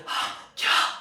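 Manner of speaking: hushed whisper asking for answers; student whispering to a classmate for an answer. Retold or imitated. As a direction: whisper urgently across the desk. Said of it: "Munni सब कह रहे".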